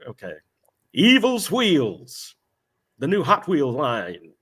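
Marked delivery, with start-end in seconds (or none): 0.9-2.4 very slow, higher trailer read; mock-dramatic slow tagline; drawn-out tagline. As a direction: stretch it out like a cheesy ad. Reading tagline aloud.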